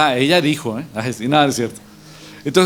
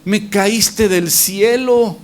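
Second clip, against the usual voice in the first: high, drawn-out delighted exclamation; acted joyful relief; 7 semitones higher, much slower than usual. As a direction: act out greeting your rescuer. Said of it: "se le iluminó la carita".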